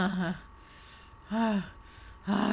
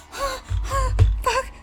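very high gasping cries; climax gasps; 9 dB louder than usual. Real, not acted. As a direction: gasp out at the peak. Climax at boss kill.

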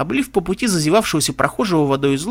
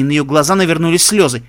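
ordinary delivery

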